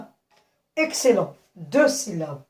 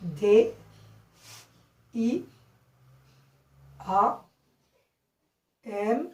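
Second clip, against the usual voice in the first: letter by letter with long pauses; spelled out; slower than usual. Shown as a demonstration. Teacher spelling diamant for the class, spelled out. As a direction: spell it slowly, each letter on its own. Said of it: "il s'écrit".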